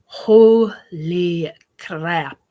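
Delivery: slow, loud holy crap; savoring the memory of cheesecake; drawn-out exclaim. Real, not acted. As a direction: stretch it out in awe. Raving about homemade cheesecake.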